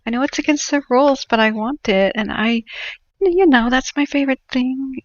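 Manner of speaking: high, wheedling plea; mock-sweet begging for a favor; imitating a Karen begging a clerk, high and pleading. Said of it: "they'll first beg and say".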